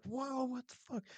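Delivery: high, startled shriek of disbelief; shocked scream. Retold or imitated. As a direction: yelp it out as if you just saw something impossible. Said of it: "like, I screamed".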